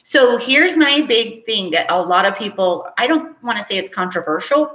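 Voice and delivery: ordinary delivery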